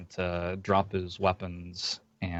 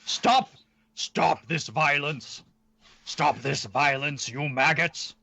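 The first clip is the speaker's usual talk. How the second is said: raised, high-pitched shout in character; yelling; 6 semitones higher, 3 dB louder, slower than usual. Acted. A priestly dwarf yells at fighters from a distance, yelling. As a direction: yell it out as a priestly order. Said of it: "and he's yelling".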